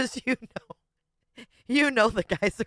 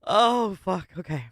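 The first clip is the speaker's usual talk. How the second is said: normal talking voice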